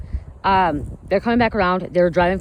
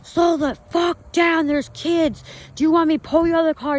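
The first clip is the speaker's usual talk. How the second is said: much higher, slower, furious retold yell; angry yelling; 10 semitones higher than usual. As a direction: bark it out like a protective dad at speeding kids. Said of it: "started yelling".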